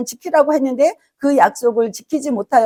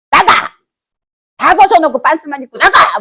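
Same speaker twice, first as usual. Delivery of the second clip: loud, high acted shout; angry parent; 4 semitones higher, 7 dB louder than usual. Parent throwing a disobedient child out, angry shout. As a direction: bellow it like a furious parent. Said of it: "막 이게 화가 나서".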